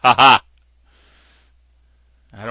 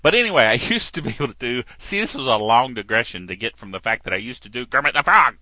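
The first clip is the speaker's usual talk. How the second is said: ordinary delivery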